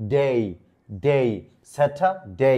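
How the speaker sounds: "ডে" as a long gliding "day"; correct English pronunciation. Shown as a demonstration. Teacher drilling the new ending, "ডে" carefully pronounced as long "day". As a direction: separate each "ডে", then "স্যাটারডে" as "satha-day" without R. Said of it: "ডে আজকে থেকে বলবো না".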